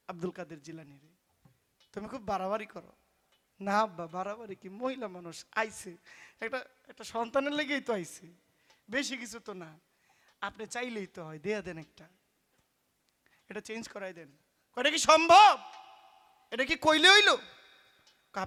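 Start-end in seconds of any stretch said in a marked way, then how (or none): 0.1-14.3 put-on soft pleading child. Child pleads softly with father. 14.8-17.3 higher, louder outraged questions; high, loud incredulous father; indignant protest. Acted.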